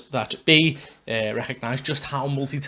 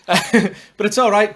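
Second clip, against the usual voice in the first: laughing; 6 semitones higher, 6 dB louder, slower than usual. Real, not acted. Laughs at rival podcast.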